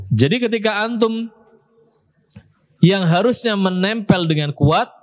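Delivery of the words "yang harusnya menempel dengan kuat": ordinary talk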